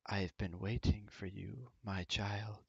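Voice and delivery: soft yet booming voice of a goddess; gentle but booming, like a voice from everywhere. Acted. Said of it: "in a soft but still, you know, booming voice".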